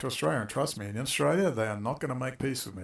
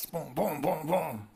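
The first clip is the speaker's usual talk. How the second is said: mock gunshots; 4 semitones higher, slower than usual. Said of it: "I'll say".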